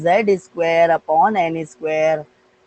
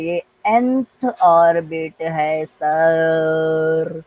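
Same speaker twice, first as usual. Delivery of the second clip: slow, long-held words ending on a lengthened "sir"; drawn-out pupil answer; 2 semitones lower, much slower than usual. Acted. Pupil answers the teacher which orbit it is, drawn out. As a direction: stretch each word out very slowly.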